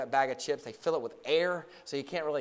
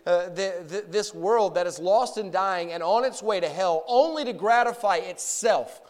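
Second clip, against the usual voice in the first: raised, higher-pitched preacher's voice; forceful preaching; 5 semitones higher, 9 dB louder than usual. Real, not acted. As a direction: preach it out to the whole congregation.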